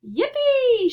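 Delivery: high, loud whoop of joy; gleeful shout. Acted. Grandpa Joe whooping as the elevator rockets up, gleeful shout. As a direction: let out an old man's thrilled yippee. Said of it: "shouted Grandpa Joe".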